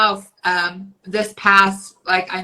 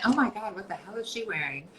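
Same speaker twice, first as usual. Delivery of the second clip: catty inner judgment; 13 dB quieter than usual. Retold or imitated. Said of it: "what do you say to yourself".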